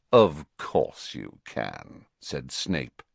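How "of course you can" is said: slow, low sneer. A narrator's reading. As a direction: draw it out with contempt.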